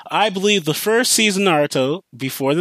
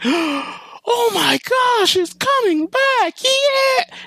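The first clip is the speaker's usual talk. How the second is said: much higher, slow, squealing joy; overjoyed fan; much slower than usual. Retold or imitated. Fan hearing Naruto is coming back, squealing with joy. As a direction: gush like a fan hearing great news.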